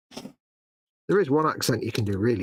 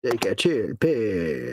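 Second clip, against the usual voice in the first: very slow, stretched-out mimicry; mocking echo; 2 semitones lower, much slower than usual. Co-host echoing his mate's catchphrase, drawn out. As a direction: drag every word out in mock imitation.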